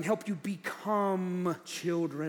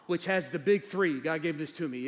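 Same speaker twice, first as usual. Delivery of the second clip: usual speaking voice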